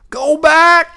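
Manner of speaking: high, loud yowl. A narrator's reading. Henry yowls at the balloon to turn around. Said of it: "yowled Henry".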